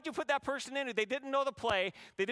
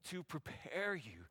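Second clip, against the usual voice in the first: hushed tender; 6 semitones lower, 10 dB quieter, slower than usual. Acted. God's tender whisper.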